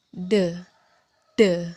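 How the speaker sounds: D with tongue tip on the upper palate; voiced D sound. Shown as a demonstration. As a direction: say D as a short sound, throat vibrating. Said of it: "ujung lidah ditaruh di langit-langit atas". (bunyi D, ujung lidah di langit-langit atas)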